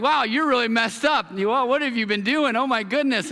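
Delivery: high, loud mock amazement; shocked. Chiropractor telling the pastor his back is wrecked, amazed. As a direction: play the stunned doctor to the hilt.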